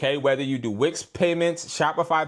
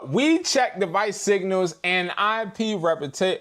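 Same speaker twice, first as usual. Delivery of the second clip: ordinary delivery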